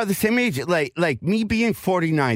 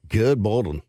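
deep, low radio-guy voice; low radio announcer put-on; 7 semitones lower than usual. Shown as a demonstration. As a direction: drop way down into a mock DJ greeting. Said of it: "why I talk like that".

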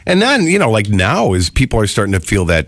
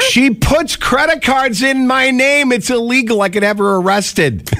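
mock outrage; 14 semitones higher than usual. Mock outrage about wife.

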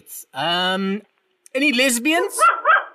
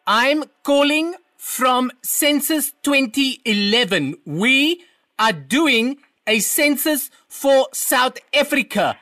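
slow and raised voice; to someone hard of hearing; 3 semitones higher, slower than usual. Acted. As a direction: stretch the words out slowly. Slow loud census questions.

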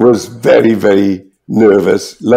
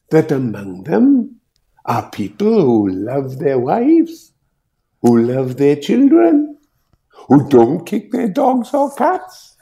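soft, slow, climbing higher; voice rising; 4 semitones higher, 3 dB quieter than usual. Retold or imitated. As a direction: let the voice rise slowly, line by line. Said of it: "his voice going up a bit".

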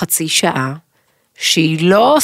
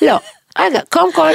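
ordinary talk, nothing marked

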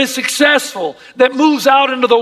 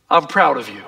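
hushed tender blessing; 11 semitones lower, 4 dB quieter than usual. Shown as a demonstration. Father's blessing spoken to child.

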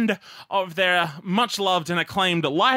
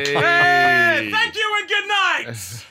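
announcer shout; 10 semitones higher, 3 dB louder, much slower than usual. Pretend. Mock concert sign-off shout.

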